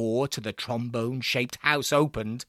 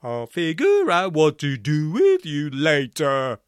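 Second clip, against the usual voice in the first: loud brassy threat; menacing trombone voice; 2 semitones higher, 5 dB louder than usual. A narrator's reading. Barnstable threatens the prisoners before locking the gate. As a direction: promise trouble later.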